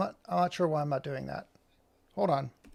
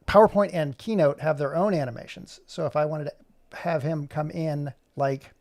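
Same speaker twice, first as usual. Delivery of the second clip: ordinary delivery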